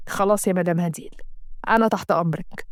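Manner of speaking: a lightly voiced servile doctor; low submissive doctor; doctor's voice.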